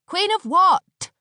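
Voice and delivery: high, loud sharp retort; snapping. A narrator's reading. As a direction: snap back impatiently. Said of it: "snapped Aneshka".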